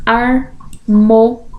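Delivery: slow, spaced syllables in "Harmônico". Shown as a demonstration. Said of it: "Separação de sílabas".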